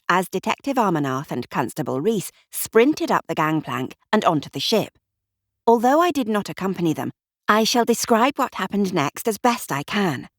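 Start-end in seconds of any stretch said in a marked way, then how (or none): none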